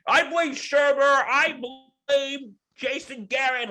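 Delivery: high-pitched mock blaming rant; Joe Judge ranting. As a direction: whine it out like a coach making excuses. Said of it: "he just kinda went off".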